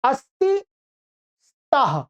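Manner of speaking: high, careful chant of each verb form; teacher modeling Sanskrit forms; model recitation. Shown as a demonstration. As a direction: say each form clearly for students to copy. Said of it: "एक बार सुनिएगा, पहले मैं बोलता हूं".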